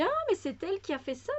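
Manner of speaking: high, quick burst of delight; excited inner thought. Retold or imitated. Recalling her delight at the workbook, excited. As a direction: delight on finding out she made it. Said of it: "je me suis dit".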